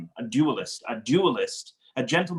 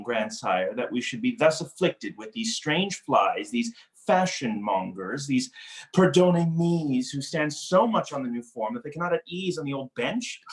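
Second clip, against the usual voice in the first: ordinary talk, nothing marked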